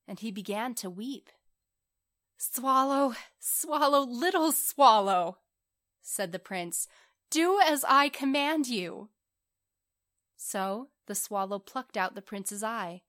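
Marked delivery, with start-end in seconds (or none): 2.4-5.4 high, loud, drawn-out appeal; insistent calling. Acted. Prince to reluctant swallow, calling insistently. 7.3-9.1 high, loud royal order; commanding. Acted. Prince orders reluctant swallow, loud and commanding.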